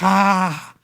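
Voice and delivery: high, drawn-out mock cry; dad's yelp, retold without real volume; imitated startled yelp. Retelling dad getting jumped on, imitated high yelp.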